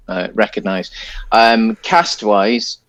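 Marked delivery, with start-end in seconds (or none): none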